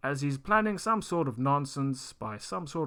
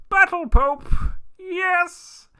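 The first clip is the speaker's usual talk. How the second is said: excited shout; 13 dB louder, much slower than usual. Real, not acted. Sudden idea, triumphant cry.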